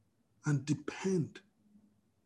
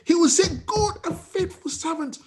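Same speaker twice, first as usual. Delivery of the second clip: preacher proclaiming to the congregation; full-force preaching; 9 semitones higher, 6 dB louder than usual. Acted.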